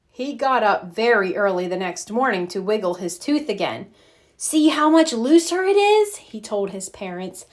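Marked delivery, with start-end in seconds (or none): 4.3-6.2 put-on eager boy. Arthur eager to parents.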